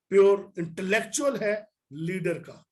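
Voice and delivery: ordinary talk, nothing marked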